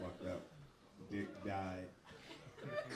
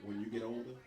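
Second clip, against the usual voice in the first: father voice; 9 semitones lower, 10 dB quieter than usual. Retold or imitated. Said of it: "He was like".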